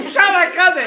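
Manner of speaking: high, loud mock shout; outraged retold shout; retold accusing yell. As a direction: holler it at far-off shooters. Yelling back at the shooters.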